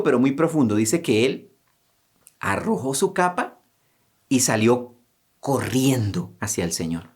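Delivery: normal talking voice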